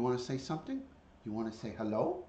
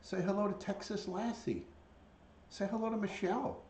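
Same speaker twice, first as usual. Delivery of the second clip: higher, coaxing prompt; pet-talk voice; 6 semitones higher than usual. Real, not acted. Owner asking a bird to greet viewers, high pet-talk. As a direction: coax the pet in a raised pitch.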